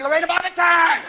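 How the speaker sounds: shouted threat, high and loud; mock battle cry. Wife shouting a threat at husband, loud. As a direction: yell it like a war cry.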